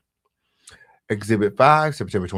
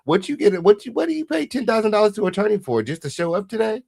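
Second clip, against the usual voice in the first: much higher, disbelieving rant; incredulous; 10 semitones higher than usual. Real, not acted. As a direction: scoff at paying the attorney. Mocking attorney fee.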